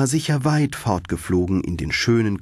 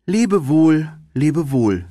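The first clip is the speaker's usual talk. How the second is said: higher, louder, a plaintive goodbye; tearful farewell; 7 semitones higher, 4 dB louder than usual. Acted. Thumbelina bids the red flower farewell, plaintive and high. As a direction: call out a sad goodbye, voice lifted high.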